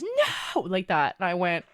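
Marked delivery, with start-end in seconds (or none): 0.0-0.6 very high, loud mock scream; shrieked retold "no"; imitated scream. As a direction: mimic a friend screaming in protest. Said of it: "She started screaming. She goes".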